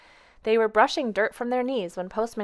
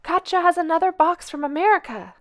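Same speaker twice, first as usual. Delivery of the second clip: high excited holler from down the street; 9 semitones higher, 6 dB louder than usual. A narrator's reading. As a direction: call it out high and loud with joy. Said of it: "His shouts drew".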